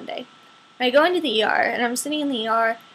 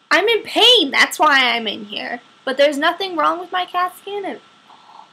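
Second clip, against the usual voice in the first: exasperated protest; 5 semitones higher, 6 dB louder than usual. Retold or imitated. Arguing with ER staff.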